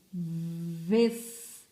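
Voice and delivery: "Ves-" alone, starting on a long buzzing v; first syllable isolated. Shown as a demonstration. Teacher showing the first sound out of her mouth, "Ves-" stretched. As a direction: hold the v, then release "Ves-". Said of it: "primeiro som que sai da minha boquinha".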